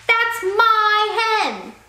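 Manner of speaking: very slow, loud, high angry cry; a loud drawn-out furious yell. A narrator's reading. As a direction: roar the claim in fury. Furious fisherman chasing.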